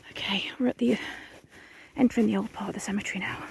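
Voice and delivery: hushed ghost-hunt whisper, a bit quick. Real, not acted. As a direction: whisper so nothing hears you.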